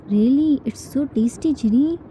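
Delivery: ordinary delivery, nothing marked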